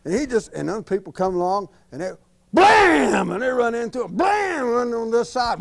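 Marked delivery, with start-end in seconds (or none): 2.5-3.3 high loud mock crash noise. Narrator mimics bumper cars smashing into Dolphus, loud crash noise. 4.2-4.7 bang it out like a collision; mock crash. Bumper cars crash again.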